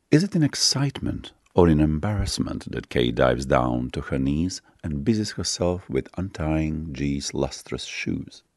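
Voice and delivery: usual speaking voice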